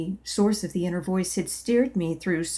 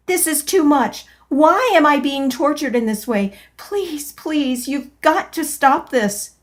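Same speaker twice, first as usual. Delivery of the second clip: higher, louder desperate plea; panicked pleading; 7 semitones higher, 6 dB louder than usual. Acted. Tortured by pain, pleading to C E in a higher, louder panic. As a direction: beg in panic. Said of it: "I gasped".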